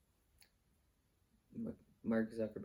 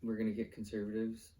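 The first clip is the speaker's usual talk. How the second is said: ordinary delivery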